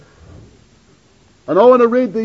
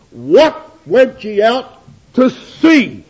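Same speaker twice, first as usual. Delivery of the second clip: slow, weighty biblical question, louder; solemn scripture quote; 3 semitones higher, 5 dB louder, much slower than usual. Retold or imitated. Preacher to congregation, quoting Jesus: slow, solemn and louder. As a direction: draw out each word like reading from the pulpit.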